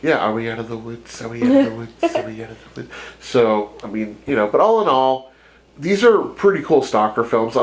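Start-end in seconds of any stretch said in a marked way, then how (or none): 1.2-2.2 much higher, frightened little question; squeaky scared voice. Acted. Voicing the babysitter in the woods, high and scared. Repeating the line in different voices. 2.2-2.9 much softer, almost under the breath; hushed question. Acted. Voicing the babysitter hiding in the woods, hushed. Repeating the line in different voices.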